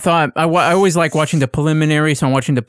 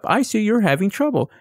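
mock chatbot voice, higher pitched; automated chat assistant; 5 semitones higher than usual. Quoting the cable company's pop-up chat, mock-helpful. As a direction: imitate a polite pop-up helper, pitched up. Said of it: "It pops up".

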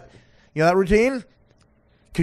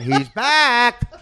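yelled out; 9 semitones higher, 5 dB louder than usual. Retold or imitated. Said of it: "and I went".

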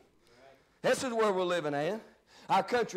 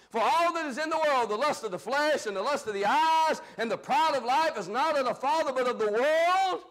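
building scripture preaching; 5 semitones higher than usual. Real, not acted. Preacher warns God's people against worldliness, building fervent preaching. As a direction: recite the verse, rising into fervent preaching.

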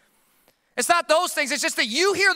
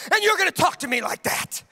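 indignant rant; 7 semitones higher than usual. Retold or imitated. Ranting at toddler.

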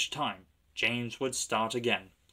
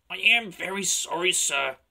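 apologetic; 7 semitones higher, 7 dB louder than usual. A narrator's reading. James apologises to his boss.

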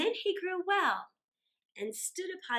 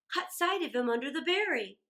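plain reading voice

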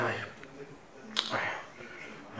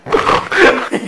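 breathy laugh; 12 dB louder than usual. Real, not acted. Struggling to eat through mask.

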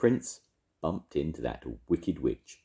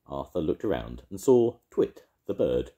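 ordinary delivery, nothing marked

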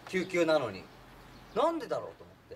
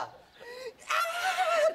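very high laughing imitation of her cry; mock shriek. 女の子の叫びの再現. (高い声で女の子の叫びを真似て)

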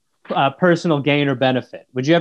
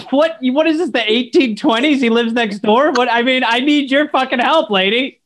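mock outrage; 7 semitones higher than usual. Son mock-outraged at mum's answer.

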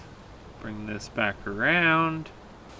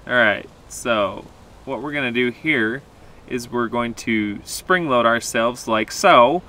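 usual speaking voice

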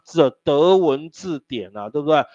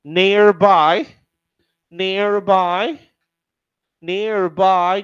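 slow loud drill; 4 semitones higher, 8 dB louder, much slower than usual. Shown as a demonstration. Teacher drills a word for student.

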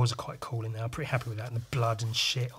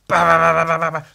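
loud ba ba ba gunfire; shouted mock gunfire; gunshot noises; 2 semitones higher, 11 dB louder, faster than usual. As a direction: bang them out like shots. Voicing gunfire in comic.

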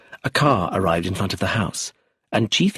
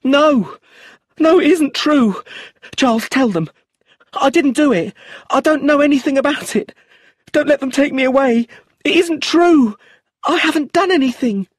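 high, loud desperate screaming; 6 dB louder than usual. A narrator's reading. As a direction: shriek the denials in panic. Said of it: "She held on to me and screamed".

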